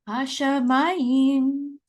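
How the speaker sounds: Hashemayim drawn out slowly, syllable by syllable; slow Hebrew reading. Shown as a demonstration. Teacher sounding out Hashemayim slowly for students. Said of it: "with the word Hashemayim says".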